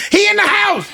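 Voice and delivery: high shouted alarm; kid's panicked shout. Retold or imitated. Son yells that the dog got inside, high panicked shout. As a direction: yell it in a panic.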